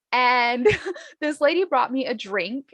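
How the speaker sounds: usual speaking voice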